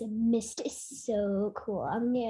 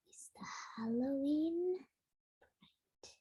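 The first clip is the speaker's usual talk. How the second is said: whispering; 11 dB quieter, much slower than usual. Real, not acted. Whispered aside during song.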